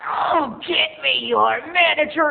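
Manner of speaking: high, loud, slow sassy demand; diva mom. Retold or imitated. Mom demanding manager, high loud sassy. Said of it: "And she's like".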